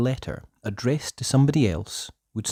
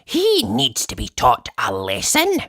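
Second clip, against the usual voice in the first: much higher, eager townswoman; lady's voice; 10 semitones higher, 3 dB louder than usual. Acted. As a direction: chirp it like the laundry lady. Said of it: "said Missus Washer".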